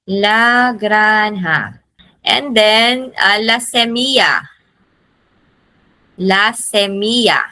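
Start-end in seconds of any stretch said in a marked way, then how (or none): 0.1-1.8 very slow, drawn-out "La granja"; slow model pronunciation. Shown as a demonstration. Teacher has class repeat after her, very slowly. 3.4-7.5 semilla said "semiya"; double L as Y. Shown as a demonstration. Teacher modelling semilla for repetition, LL as Y. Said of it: "Double L is Y".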